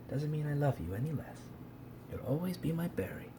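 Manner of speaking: soft, affectionate murmur; quiet, loving consolation; tender reassurance. Real, not acted. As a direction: tell a pet softly that it is loved. Consoling the mute cat fondly.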